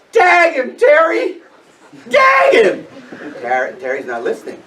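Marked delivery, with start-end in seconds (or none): 0.1-2.9 high, loud urgent shouts; teammates yelling. Retold or imitated. Everybody yelling to Terry to tag him, high urgent shout. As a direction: yell it out to a catcher who isn't listening. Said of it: "Everybody is saying".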